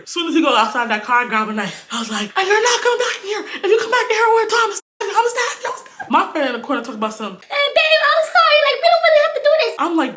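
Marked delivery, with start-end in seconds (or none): 2.4-6.1 high-pitched retold warning; mock threat. Friend with knife warning boyfriend away, high mock threat. 7.4-9.8 put-on very high, quick girly apology; mock pleading. Friend in corner apologizing to boyfriend, squeaky mock pleading.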